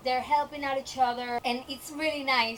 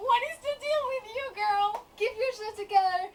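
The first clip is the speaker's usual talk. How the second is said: high, fast, laughing mock scold; exasperated scolding; 8 semitones higher, 4 dB louder, faster than usual. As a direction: snap at her to pull it together. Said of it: "And I'm like".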